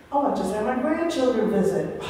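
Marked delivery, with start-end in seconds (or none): none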